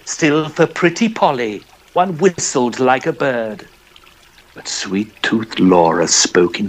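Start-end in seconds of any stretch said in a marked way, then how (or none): none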